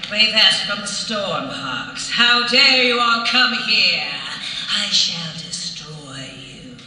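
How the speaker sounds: slow, loud hammy villain; slow grandiose evil threat; Ray Vest villain voice. Acted. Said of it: "And then Ray Vest was".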